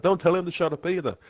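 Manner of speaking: high-pitched scolding retort; retold telling-off, pitched well up; mock indignant rebuke. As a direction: act out a cop having none of it. Acted quarrel with police.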